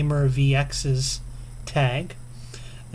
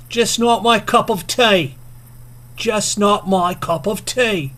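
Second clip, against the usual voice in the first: high, loud, hammed-up British accent; mock British accent; 7 semitones higher, 5 dB louder than usual. Vlogger saying the tag's phrase first, mock British accent. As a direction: ham up a British accent, pitched well up. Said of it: "in your best British, uh, accent".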